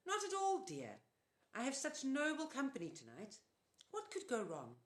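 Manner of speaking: put-on high sugary old lady reassuring; sweet false reassurance. A narrator's reading. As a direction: soothe them while hiding menace. Auntie Em reassuring.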